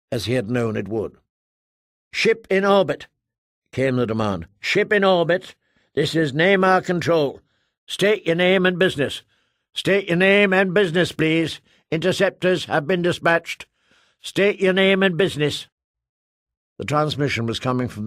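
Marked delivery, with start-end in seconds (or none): 2.1-3.1 higher, louder official hail; stern radio control officer. Acted. Namor Control demanding the ship identify itself, official. 4.6-15.7 higher, louder, insistent demand; officious control officer. Acted. Namor Control demanding name and business, insistent official.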